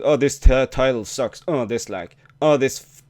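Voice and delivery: ordinary delivery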